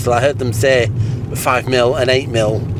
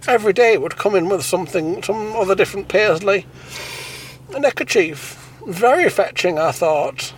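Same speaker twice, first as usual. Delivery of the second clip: much higher, dreamy and fussy; much higher puppet voice swooning over a neckerchief; prissy high puppet voice; 7 semitones higher than usual. Acted. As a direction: gush about Kenneth like a prim puppet. High puppet voice held over many lines.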